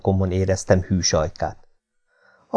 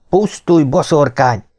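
higher, sudden angry cry; angry shout; 6 semitones higher, 3 dB louder than usual. A narrator's reading. Man cursing the witch as he draws his dagger, angry shout. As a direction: burst out in sudden rage. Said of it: "kiáltottam hirtelen haragomban".

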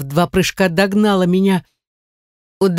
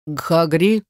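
gruff man's question. Acted. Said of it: "спросил меня самый здоровый рыжеволосый мужчина".

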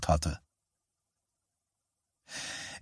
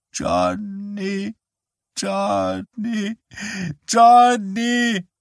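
frail mother calling, building; 13 semitones higher, much slower than usual. Acted. Mother calls her son repeatedly.

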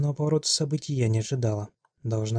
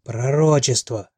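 slow, much louder drawn-out yell; old woman yelling; 7 dB louder, much slower than usual. A narrator's reading. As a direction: howl the word out. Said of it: "заорала старуха".

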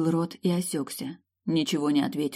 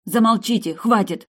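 loud, high shout; shouting stop; 6 semitones higher, 7 dB louder than usual. A narrator's reading. The heroine shouts the quarrelling men into silence, loud and high.

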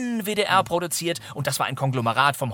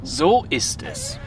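slow knowing drawl; 8 semitones higher, much slower than usual. Acted. Detective confirms slowly.

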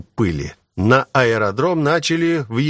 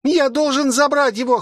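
put-on high child's voice, straining; boy's high-pitched plea; desperate boy. A narrator's reading. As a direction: insist in a high, drawn-up cry. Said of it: "хрипел мальчишка".

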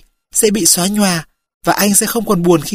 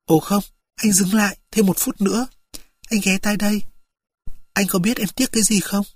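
soft, weak whisper; 4 semitones higher, 6 dB quieter than usual. A narrator's reading. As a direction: breathe it softly into his ear. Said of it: "Cô thì thào".

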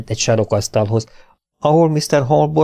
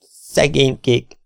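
nervous stammer; 4 semitones higher, slower than usual. A narrator's reading. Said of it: "dadogta rosszat sejtve".

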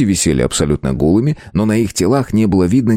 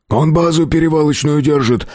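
higher-pitched defensive whine; pleading captive thug; 8 semitones higher than usual. A narrator's reading. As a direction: make excuses in a strained high voice. Captive eager to inform. (пленный бандит оправдывается, высоким голосом)

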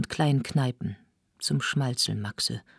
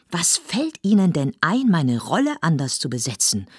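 loud, higher indignant shout; 4 semitones higher, 6 dB louder than usual. Acted. Lotte shouts at Zilenziger over her recast role, indignant. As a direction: yell your outrage. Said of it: "schrie nun auch Lotte".

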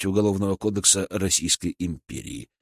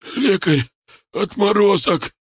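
loud, high, strained rasp; hoarse croak; 10 semitones higher, 4 dB louder, slower than usual. A narrator's reading. Шар curses the healer from where he lies, hoarse croak. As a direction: rasp out the insult through pain. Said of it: "прохрипел Шар со своего места".

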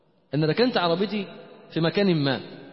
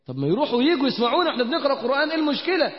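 quick, higher-pitched challenge; exasperated retort; 6 semitones higher, faster than usual. Retold or imitated. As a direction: fire it back fast, pitch climbing. Retold exasperated retort to shy friend.

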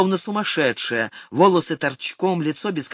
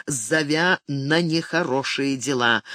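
ordinary delivery

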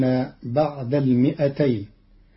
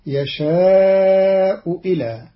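"يشاء إلى" chanted slowly, higher and louder; slow recited phrase; 4 semitones higher, 4 dB louder, much slower than usual. Shown as a demonstration. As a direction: draw out "يشاء إلى" in tartil. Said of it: "قرأ قالون كلمتي يشاء إلى". (تلاوة بطيئة مرتفعة)

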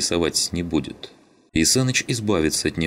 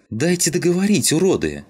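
high, angry yell dropping on the last word; 13 semitones higher, 4 dB louder than usual. A narrator's reading. Родька shouts down the men interrupting her, yelling. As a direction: yell to be allowed to finish. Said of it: "заорала совсем не по-детски Родька".

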